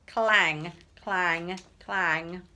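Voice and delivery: booming clang sound effect; loud clangs. A narrator's reading. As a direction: bang out each clang. Shoe banging robot sound.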